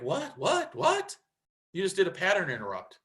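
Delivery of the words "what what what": high, bewildered repeated what; baffled. Retold or imitated. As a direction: thrown completely off guard. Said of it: "You're like".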